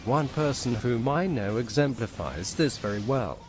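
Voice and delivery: ordinary delivery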